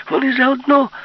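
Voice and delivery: loud shout. Acted. Yulka shouting the warning again, full scream. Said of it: "вигукнула Юлька".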